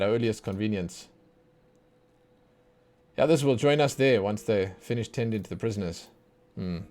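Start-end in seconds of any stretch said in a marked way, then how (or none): none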